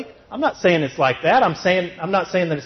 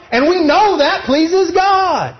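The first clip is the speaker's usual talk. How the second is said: slow, high, with "know" punched; emphatic refrain; 13 semitones higher, 6 dB louder, slower than usual. Acted. Preacher repeats his refrain, leaning on know. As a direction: lean hard on "know".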